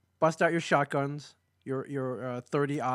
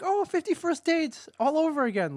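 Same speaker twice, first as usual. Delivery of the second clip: mocking audience voice; 12 semitones higher than usual. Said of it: "They're like".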